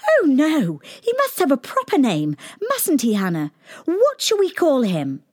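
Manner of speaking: very high, quick eager princess; cartoon princess. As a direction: ask brightly like a little girl. Princess chats with Hannah.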